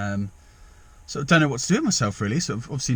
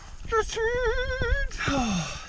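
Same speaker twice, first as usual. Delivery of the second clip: long pitched-up sigh of dismay; high exasperated sigh; 4 dB quieter than usual. Real, not acted. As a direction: sigh it out, embarrassed.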